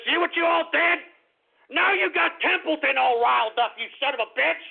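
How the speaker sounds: heated accusing outburst; angry blaming. Real, not acted. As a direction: turn on the audience in exasperation. Blaming listeners for riling Templeton.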